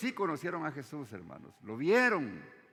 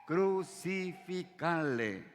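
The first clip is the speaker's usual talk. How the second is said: mock crowd chant, slow and higher; grito imitado de multitud; 4 semitones higher, much slower than usual. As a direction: stretch each syllable out like a retold cry. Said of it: "y todos le gritaron". (grito de multitud imitado, lento y alargado)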